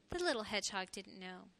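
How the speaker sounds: ordinary delivery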